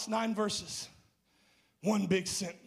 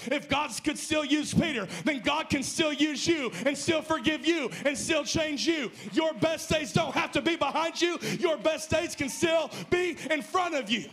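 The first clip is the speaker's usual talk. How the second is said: rising, shouted preacher's climax; preaching at full force; 5 semitones higher than usual. Acted.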